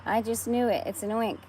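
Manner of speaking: police chief yelling in alarm; yelled. A narrator's reading. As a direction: shout it out in a panic. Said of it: "yelled the chief of police".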